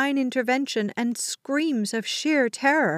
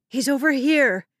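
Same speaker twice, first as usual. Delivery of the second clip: raised, higher urgent call; 4 semitones higher, 4 dB louder than usual. A narrator's reading. Woman telling mother where the boy is, calling out. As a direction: call across the noise.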